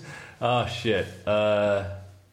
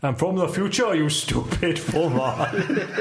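higher, very slow, laughing exclaim; buzzer quote voice; 5 semitones higher, much slower than usual. Acted. Richard buzzes in with his catchphrase. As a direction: drag out the insult theatrically. Buzzer sound before answering.

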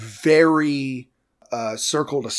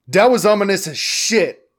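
louder, higher exclamation; loud blurt; 8 semitones higher, 7 dB louder than usual. Acted. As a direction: blurt it out, half-laughing. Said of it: "Chief Toomba says".

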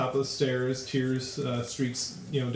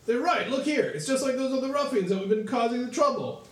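calling guard; 9 semitones higher than usual. Acted. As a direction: shout it over to the captain like a common town guard. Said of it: "and he says".

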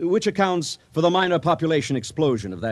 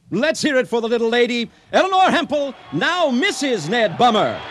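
much higher, louder emcee shouting over a crowd; announcer calling out; 11 semitones higher, 4 dB louder than usual. Acted. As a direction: hype up the audience like a showman. Emcee presents the bride to audience.

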